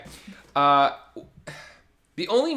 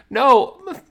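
high squeaky excuse breaking into laughter; embarrassed excuse; 14 semitones higher, 6 dB louder, slower than usual. Retold or imitated. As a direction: retell a flustered lie while cracking up. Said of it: "I got really embarrassed".

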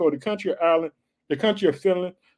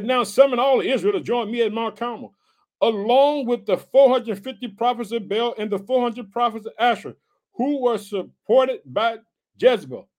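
scripture reading; 6 semitones higher, 4 dB louder than usual. Acted.

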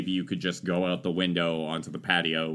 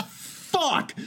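high, strained mock curse; retold angry outburst. Son retelling dad's car being hit, mock furious outburst. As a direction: imitate dad's sudden furious expletive. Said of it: "my dad is like".

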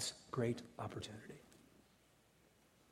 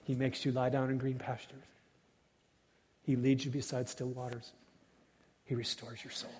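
ordinary delivery

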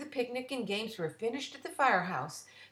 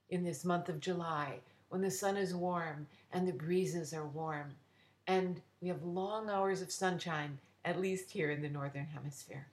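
plain reading voice

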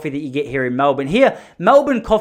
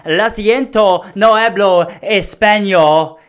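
loud, drawn-out Spanish phrase; slow, loud non-speaker's Spanish; overblown Spanish attempt; 4 semitones higher, 6 dB louder, much slower than usual. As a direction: belt it out very slowly in clumsy Spanish. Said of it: "You can't come out going".

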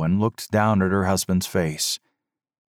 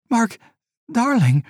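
joyful cry; 4 dB louder than usual. A narrator's reading. Wife sees husband wake.

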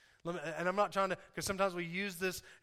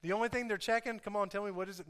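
booming motivational speech; 4 semitones higher than usual. Acted. Preaching at full force.

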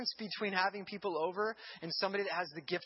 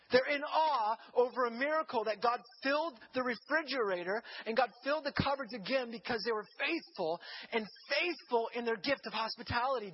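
raised, rising storytelling preach; excited preaching; 5 semitones higher, 4 dB louder than usual. Acted. Preacher telling the congregation of a miracle, raised and excited. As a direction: build the miracle story with fire.

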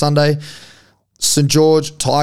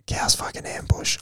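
hushed, amazed under the breath; stunned whisper; 11 dB quieter than usual. Real, not acted. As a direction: whisper it in disbelief. Stunned delight at the bet.

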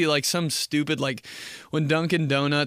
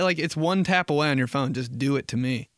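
usual speaking voice

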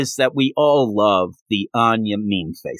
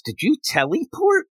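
much higher, baffled question; mock baffled child; 14 semitones higher than usual. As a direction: ask it in total disbelief. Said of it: "actually says".

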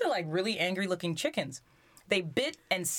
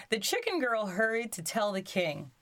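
ordinary delivery

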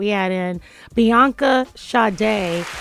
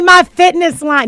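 mock sales pitch; 5 semitones higher, 8 dB louder, slower than usual. Mock pitching her own brand.

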